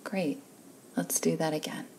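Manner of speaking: ordinary delivery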